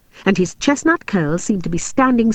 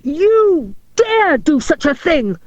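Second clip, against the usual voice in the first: slow, high, furious outburst; exploding; 8 semitones higher, slower than usual. A narrator's reading. Jealous Robin forbidding Maria, exploding. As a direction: spit each word in outrage. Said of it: "he exploded".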